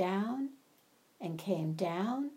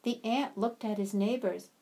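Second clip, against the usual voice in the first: usual speaking voice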